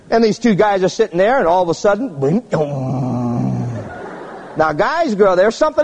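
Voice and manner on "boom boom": heartbeat thumps. Retold or imitated. Heartbeat imitation.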